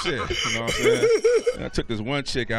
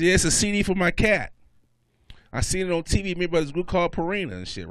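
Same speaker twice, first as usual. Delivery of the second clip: put-on high, raised girlfriend voice; girlfriend impression voice; 8 semitones higher, 5 dB louder than usual. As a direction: explain insistently as the girlfriend, pitched up. Girlfriend explains her silly mistake.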